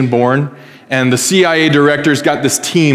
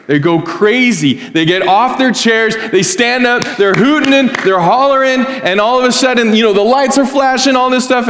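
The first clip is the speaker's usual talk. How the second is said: excited storytelling; 10 semitones higher than usual. Real, not acted. Crowd going wild retold.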